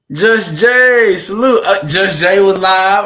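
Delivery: high and loud salute, slowing down; loud shout-out. Real, not acted. Streamer greeting his friend Just Jay, loud slow shout-out. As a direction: call out to him loud and slow.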